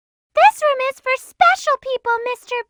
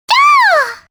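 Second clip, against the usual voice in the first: high, loud short scream; sharp frightened cry; startled scream; 8 semitones higher, 5 dB louder than usual. Acted. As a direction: yelp in sudden alarm. Character screams.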